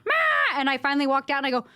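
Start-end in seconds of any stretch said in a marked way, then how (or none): 0.0-0.6 very high mock child's cry; whining kid. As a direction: imitate a squabbling child calling for mother. Imitating fighting kids calling mother.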